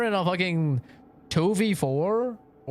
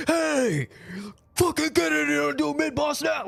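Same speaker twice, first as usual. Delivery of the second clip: mock angry yell; 11 semitones higher than usual. Shown as a demonstration. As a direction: yell at the team like a tyrant. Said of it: "I'm not like".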